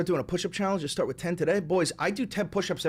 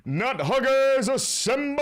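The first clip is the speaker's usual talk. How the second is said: rallying call; 9 semitones higher, 4 dB louder, slower than usual. Acted. Rallying his viewers.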